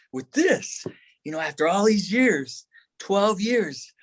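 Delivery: high-pitched retold anguish; distressed. Thinking of leaving his brother for a mission, distressed. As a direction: let the voice climb in protest.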